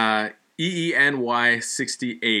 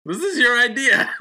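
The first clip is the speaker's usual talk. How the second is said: high, laughing jab at his cohost; laughing tease; 12 semitones higher, 3 dB louder than usual. Real, not acted. Laughing at the host who had the idea. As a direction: tease him through a laugh.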